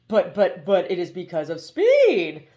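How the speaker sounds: clipped robotic bird lecturing; hoopoe voice. A narrator's reading.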